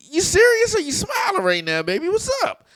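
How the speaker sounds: very high, laughing disbelief; comically astonished questioning; incredulous retelling. Retold or imitated. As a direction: pitch way up, baffled by what you see. Acts out startled reaction to makeup.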